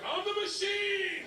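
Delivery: high-pitched, drawn-out holler; exultant yell. Retold or imitated. Repeats the phrase, yelling it out.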